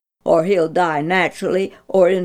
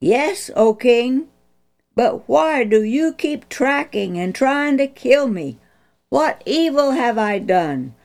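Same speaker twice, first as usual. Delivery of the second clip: earnest plea; 8 semitones higher, 4 dB louder than usual. Acted. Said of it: "David's earnest plea".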